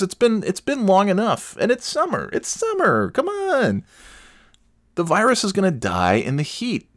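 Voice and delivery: mock-whine, rising each phrase. Mocking the reopening crowd, rising sarcastic whine.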